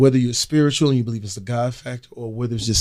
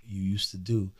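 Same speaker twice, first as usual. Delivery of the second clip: hushed advice; 4 semitones lower, 13 dB quieter than usual. Retold or imitated. Quiet advice to fellow celebrities.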